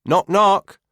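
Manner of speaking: loud, high call through the doorway. A narrator's reading. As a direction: call it out cheerily. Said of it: "I call in through the opening".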